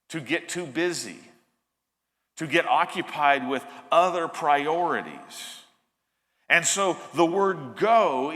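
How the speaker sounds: usual speaking voice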